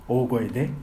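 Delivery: normal talking voice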